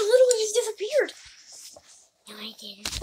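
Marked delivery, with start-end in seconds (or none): 2.3-3.0 whispering. Real, not acted.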